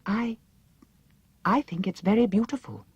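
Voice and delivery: nervous gulp. A narrator's reading. Frightened Dorothy praising the princess's head, nervous. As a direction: force out a nervous compliment. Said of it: "gulped Dorothy".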